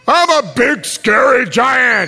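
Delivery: slow, high, projected mock menace; big scary giant. Preacher scaring the children of Israel, playful giant roar. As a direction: stretch it out as a pretend giant. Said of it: "I'm gonna be the big, scary giants".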